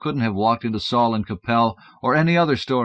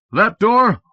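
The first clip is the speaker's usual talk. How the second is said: higher, loud foreman orders; bossy Santa; 7 semitones higher, 6 dB louder than usual. Acted. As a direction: drive the crew like a foreman in a Santa suit. Santa bossing his crew.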